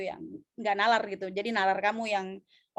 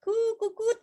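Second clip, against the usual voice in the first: high-pitched imitated bird cooing; 9 semitones higher than usual. Shown as a demonstration. Teacher showing students what a bird sounds like, high cooing. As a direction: coo like a bird, pitched well up. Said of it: "burung tuh apa ya".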